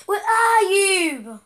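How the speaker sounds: calling out. Real, not acted. Kid calling out for his teammate, loud and drawn out. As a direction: stretch the call out loud across the map.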